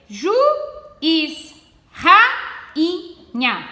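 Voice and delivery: each word read out slowly, with long pauses between; slow dictation. Shown as a demonstration. Teacher reading a word list aloud to pupils, slow and separate. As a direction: set each word apart so pupils can split the syllables. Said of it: "Então, olhe lá".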